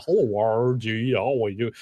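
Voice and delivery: lightly overdone "R" in a put-on greeting; awkward voice-coaching attempt, sounds unnatural; strange over-careful pronunciation. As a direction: mimic a strained attempt to say every word differently. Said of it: "I'll sound really strange because I'm trying to say everything differently".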